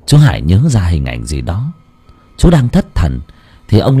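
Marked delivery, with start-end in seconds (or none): none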